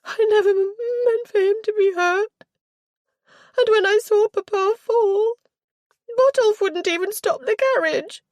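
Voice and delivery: high, loud husky near-sobbing; husky tearful voice. A narrator's reading. Girl recalling her father's fall, husky and tearful. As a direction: choke it out, near tears. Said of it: "Her voice became husky".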